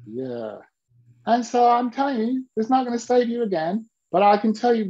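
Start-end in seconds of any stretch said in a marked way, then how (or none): 0.1-0.6 sheepish admission. Retold or imitated. Client sheepishly admitting.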